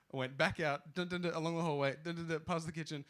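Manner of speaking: usual speaking voice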